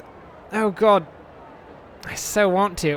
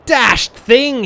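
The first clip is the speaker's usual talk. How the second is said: louder upper-class English oath; posh English curse; 4 semitones higher, 8 dB louder than usual. A narrator's reading. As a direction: curse it like a toff. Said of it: "he cursed in upper English tones".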